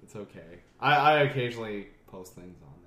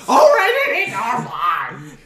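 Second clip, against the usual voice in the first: creepy mock voice; 12 semitones higher, 15 dB louder than usual. Joking reply in mock voice.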